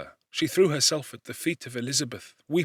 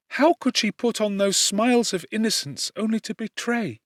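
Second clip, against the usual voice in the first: higher, lightly voiced young woman in dismay; a cousin shaken by betrayal, pitched up; grieving young woman; 7 semitones higher than usual. A narrator's reading. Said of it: "said she".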